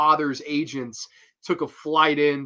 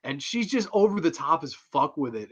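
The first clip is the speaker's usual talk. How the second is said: usual speaking voice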